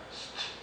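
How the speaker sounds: "st." as a bare s-t cluster; clipped st. Shown as a demonstration. Teacher isolates the sound "st.". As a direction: say "st." with no vowel. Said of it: "on le prononce parfois".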